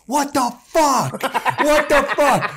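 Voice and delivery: high, slow, loud what-the-fuck at the intruder; hammed-up outrage through laughter; retold angry yell. Comic yelling at a stranger opening his hotel door, mock fury. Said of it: "I fucking pop and go".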